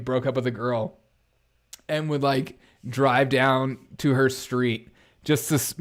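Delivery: ordinary talk, nothing marked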